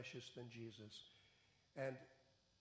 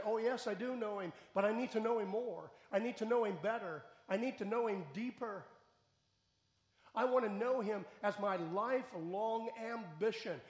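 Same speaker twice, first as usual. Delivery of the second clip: higher, louder, fast fervent pledge; burn with longing to know him more; fervent Paul; 6 semitones higher, 7 dB louder than usual. Acted. Paul answering the preacher's challenge, higher, louder and fervent.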